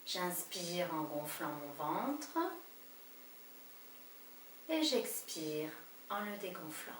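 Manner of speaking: slow, soft breathing guidance; calm them down breath by breath; guided meditation. Acted.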